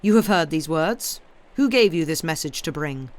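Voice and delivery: loud, stern questioning. A narrator's reading. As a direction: press him like a stern duke. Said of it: "said the duke sternly".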